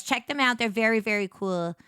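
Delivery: ordinary talk, nothing marked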